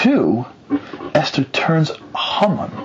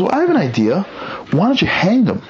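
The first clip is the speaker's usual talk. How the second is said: higher-pitched, retold eager adviser; eager suggestion; 7 semitones higher than usual. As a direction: pipe up with a bright idea. Adviser pipes up with idea.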